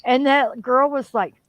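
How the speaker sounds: ordinary delivery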